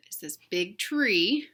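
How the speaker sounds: plain reading voice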